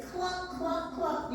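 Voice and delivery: high clucking hen. Acted. Hen answers the boy, clucking high. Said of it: "And the hen said".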